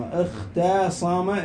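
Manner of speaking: اختصم drawn out slowly, "ikh-taa-sama"; very slow, sounded-out اختصم; broken-down demonstration. Shown as a demonstration. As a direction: stretch the taa in اختصم to show its vowel. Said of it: "تقول اخت".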